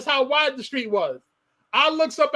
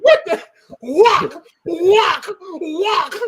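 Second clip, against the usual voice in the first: loud, high yelps of surprise and pain; retold yell; 5 semitones higher, 6 dB louder, much slower than usual. A boy hit in the shower, yelping loud and high. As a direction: yelp each word like a blow lands. Mother bursts into shower, beating.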